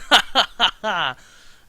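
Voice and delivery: loud, high gloating villain laugh. Acted. Kidnapper gloating after his dare, loud evil laugh. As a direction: cackle like a psycho kidnapper.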